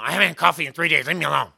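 loud, fast irritated snap; grumpy wife. Retold or imitated. Wife without coffee snapping at the pastor, loud and fast. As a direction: brush the pastor off, loud and quick.

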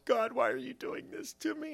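high, soft mock whine; small, high, put-upon voice; mock complaint. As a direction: whimper a mock protest up to God. Mimicking our reaction to trials.

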